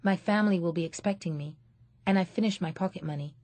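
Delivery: fast, polite refusal; quick polite reply. Acted. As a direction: hurry through a polite excuse. Child politely declining.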